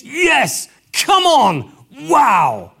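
loud, high, rousing; jubilant cheer. Acted. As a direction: cheer like a stadium crowd.

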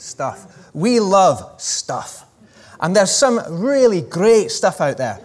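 slow, lightly put-on Scottish accent; a playful Scottish advert voice, drawn out; a hint of Scottish, preacher mimicking a TV ad; Ewan McGregor Scottish accent. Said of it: "That's my best Ewan McGregor accent".

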